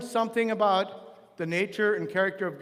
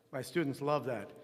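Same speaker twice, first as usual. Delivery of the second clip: ordinary talk, nothing marked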